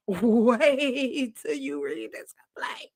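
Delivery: slow hushed whisper; whispering teaser. Real, not acted. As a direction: whisper it like juicy gossip.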